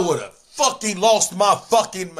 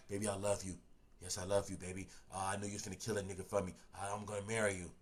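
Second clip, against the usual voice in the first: much softer, sweet-talking murmur; soft tender promise; 10 semitones lower, 22 dB quieter, much faster than usual. Retold or imitated. Inmate promising his girl marriage, softly tender. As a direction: whisper sweet nothings to her. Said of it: "I done told her".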